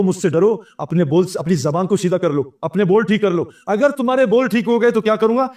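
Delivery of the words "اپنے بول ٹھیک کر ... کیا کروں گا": ordinary delivery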